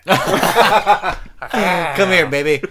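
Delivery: husky flirt through laughter; sexy growl. Pretend. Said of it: "give us a sexy growl".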